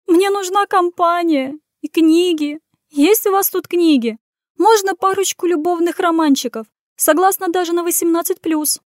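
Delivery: pleading. Acted. Continues pleading in same voice.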